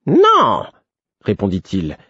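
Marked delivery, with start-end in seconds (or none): 0.1-0.7 very high, exasperated refusal; high-pitched irritated outburst; clear, annoyed. A narrator's reading. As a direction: refuse in a piercing fool's voice, irritation showing. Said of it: "d'une voix claire où perçait l'agacement".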